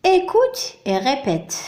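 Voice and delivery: slow, clear "Écoute et répète"; careful teacher read-aloud; slow instruction. Shown as a demonstration. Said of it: "التمرين الموالي".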